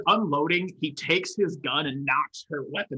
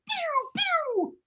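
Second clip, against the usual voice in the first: high pew pew gun sound. Acted. Imitating gunshot.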